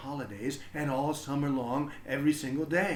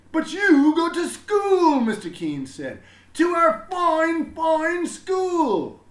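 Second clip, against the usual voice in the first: high, loud and slow, booming with pride; a beaming principal proclaiming to everyone; proud headmaster announcing; 13 dB louder, slower than usual. A narrator's reading. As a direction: announce it grandly to the whole school.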